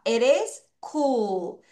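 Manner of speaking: normal talking voice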